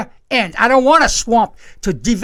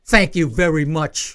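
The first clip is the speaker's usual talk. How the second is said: sarcastic thanks; 6 semitones lower, 3 dB quieter than usual. Retold or imitated. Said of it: "It's an insult".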